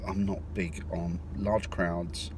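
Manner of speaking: usual speaking voice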